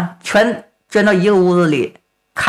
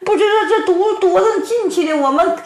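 high, fast, trembling plea; plead, shaking with fear; scared pleading; 10 semitones higher than usual. Acted. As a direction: cooks begging the marshal to see they're innocent. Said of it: "大家伙吓得哆里哆嗦呀".

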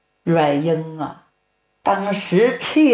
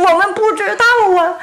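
high, fast, trembling plea; plead, shaking with fear; scared pleading; 10 semitones higher than usual. Acted. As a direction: cooks begging the marshal to see they're innocent. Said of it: "大家伙吓得哆里哆嗦呀".

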